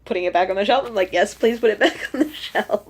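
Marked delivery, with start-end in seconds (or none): 1.1-2.9 laughing through the words. Retold or imitated. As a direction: retell it while breaking into laughter. Retells relief, laughing as she speaks.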